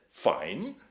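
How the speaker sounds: jeering imitation of the voice; mocking echo. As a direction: throw the word back as a joke. Robert mocks the voice.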